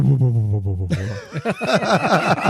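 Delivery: slow low engine pulses; staggered engine whoomps. Shown as a demonstration. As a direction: mouth the engine firing in turn. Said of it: "it kind of go whoomp".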